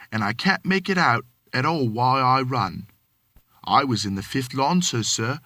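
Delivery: lightly voiced Cockney manservant, deferential; a hint of London Cockney, polite to his master; Cockney servant. Thomas answering his employer, light Cockney.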